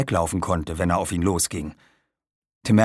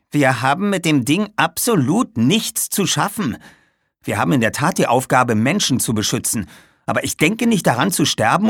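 raised, louder, emphatic indignation; angry retort; 6 semitones higher, 4 dB louder than usual. A narrator's reading. Jasper refuses to die defending a scrapyard, angry retort. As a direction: argue back crossly, hitting key words hard. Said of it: "schaute ihn böse an".

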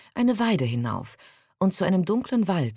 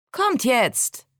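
higher, mildly raised call; 8 semitones higher, 4 dB louder than usual. A narrator's reading. Jasmine calls to friends behind her, calling out in a higher voice. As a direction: call the others along. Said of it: "rief sie".

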